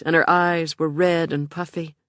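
plain reading voice

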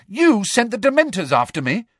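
high, loud disbelief; gasped accusation. A narrator's reading. Harry accuses Umbridge over the Dementors, shocked. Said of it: "gasped Harry".

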